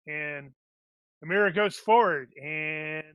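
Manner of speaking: normal talking voice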